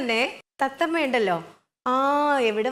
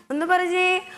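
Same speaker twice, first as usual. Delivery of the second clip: ordinary delivery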